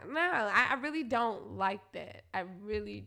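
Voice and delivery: usual speaking voice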